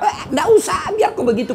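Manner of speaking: high shout, waving them off; scolding shout. Real, not acted. Preacher scolds youths who use Arabic words, sharp high shout.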